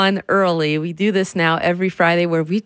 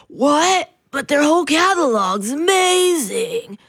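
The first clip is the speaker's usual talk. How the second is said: indignant listener; 8 semitones higher than usual. Retold or imitated. Imitating a listener's objection.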